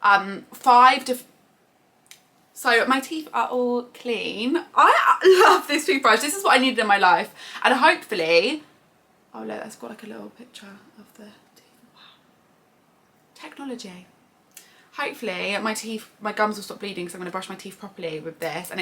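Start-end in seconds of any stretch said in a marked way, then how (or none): 4.8-7.3 gushing. Real, not acted. Gushing about toothbrush. 9.3-14.1 awed whisper. Real, not acted. Marvelling at the screen.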